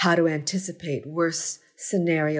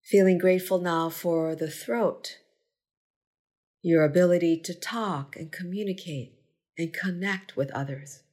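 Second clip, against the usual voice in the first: unhurried meditation guide, long pauses between phrases; measured, spacious gratitude-practice delivery; guided meditation. Acted. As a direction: lead a body scan, giving each phrase space to settle. Leads a gratitude body scan.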